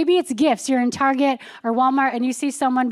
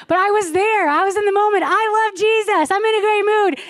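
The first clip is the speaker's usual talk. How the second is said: higher, bubbly, lightly hammed-up joy; giddy cheer; 9 semitones higher, 4 dB louder than usual. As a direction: gush about how happy she was, way up high. Recalling her cheerful mood.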